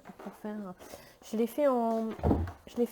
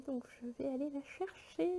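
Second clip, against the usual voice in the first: whispered aside; 4 semitones higher, 9 dB quieter than usual. Real, not acted. Aside before leaving.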